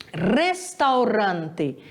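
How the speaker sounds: Restaurante with a rolled R, slow and drawn out; Spanish pronunciation. Shown as a demonstration. Teacher modeling Spanish word for students, slow and clear. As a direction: roll the R in Restaurante slowly. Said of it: "O R sempre vai ser vibrante".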